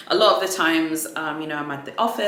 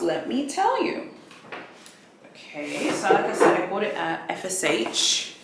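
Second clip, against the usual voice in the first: ordinary delivery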